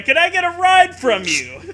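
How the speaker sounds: ordinary delivery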